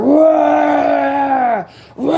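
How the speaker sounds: shouted mock roar; hammed-up lion roar. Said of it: "that motherfucker started roaring".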